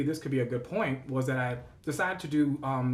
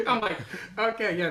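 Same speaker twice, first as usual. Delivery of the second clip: loud nervous laughter; 9 semitones higher, 8 dB louder than usual. Real, not acted. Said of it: "So this laugh is a nervous laugh".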